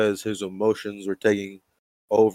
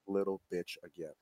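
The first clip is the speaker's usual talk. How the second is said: very soft, lower deadpan echo; second person in background; 4 semitones lower, 16 dB quieter than usual. Acted. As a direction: murmur it low, as a background line. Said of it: "second person in the background".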